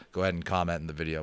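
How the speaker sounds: ordinary delivery, nothing marked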